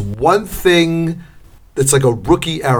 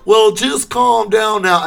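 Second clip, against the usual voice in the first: slow Southern drawl; 6 semitones higher than usual. Retold or imitated. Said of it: "And I'm like".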